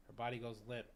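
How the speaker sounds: ordinary delivery, nothing marked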